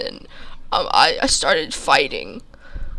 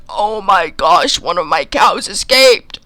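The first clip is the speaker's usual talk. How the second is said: a hint of a startled farmer, louder; 4 semitones higher, 7 dB louder than usual. Farmer spotting the narrator in the road, mildly startled. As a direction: call it out like a farmer spotting a loose cow.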